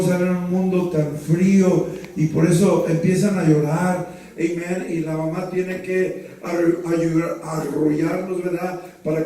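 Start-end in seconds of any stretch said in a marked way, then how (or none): none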